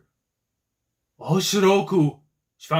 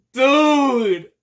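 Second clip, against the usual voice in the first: loud, high excited dude; hyped yell; 10 semitones higher, 8 dB louder than usual. Real, not acted. Fan yelling at the cliffhanger, hyped shout. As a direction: shout it in disbelief.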